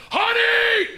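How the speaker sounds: shrieked high across the store; piercing public holler; loud shout. Acted. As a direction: yell it to embarrass your wife. Yelling across produce section.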